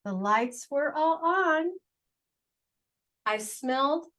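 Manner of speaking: ordinary delivery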